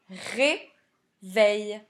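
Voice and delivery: "réveil" split as ré, pause, veil; syllable by syllable. Shown as a demonstration. Teacher giving the answer, "réveil" in two separate syllables. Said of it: "C'était la syllabe un".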